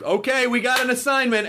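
high, urgent call to action; mock mission call. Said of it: "call and be like".

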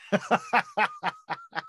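real laugh breaking out high; long high laugh. Real, not acted. Husband laughing while telling the night's story. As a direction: burst into helpless laughter.

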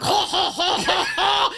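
high, loud mock villain cackle; villain's laughter. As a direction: cackle like the gloating bad guy in the background. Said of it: "you just hear Grimes' laughter".

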